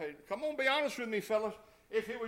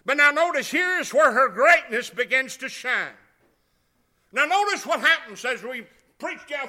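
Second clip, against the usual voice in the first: preaching; 3 semitones higher, 16 dB louder than usual. Acted. Preaching at full force.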